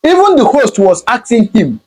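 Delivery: ordinary talk, nothing marked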